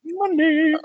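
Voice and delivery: very high, triumphant squeal; gleeful cry. Real, not acted. Host cheering the prank caller's win, high gleeful shout.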